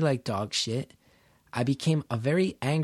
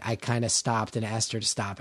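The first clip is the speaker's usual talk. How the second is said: normal talking voice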